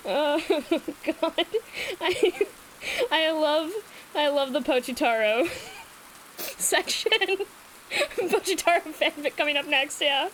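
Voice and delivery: high, giggly gushing; laughing. Real, not acted. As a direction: gush through laughter. Gushing over Pochitaro section.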